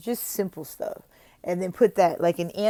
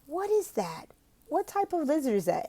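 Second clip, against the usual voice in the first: high, curious teacher questions; excited questions; 7 semitones higher than usual. Shown as a demonstration. Tutor excites the student about a Texas lizard. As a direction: make the lizard fascinating. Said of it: "It says".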